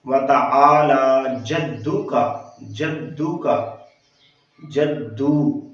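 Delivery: slow वाताआला with long आ and ला, clear long ू in जद्दू; careful elongated recitation; lengthened vowels. Shown as a demonstration. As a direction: stretch आ and ला one alif each. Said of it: "इसको भी लंबा करना है".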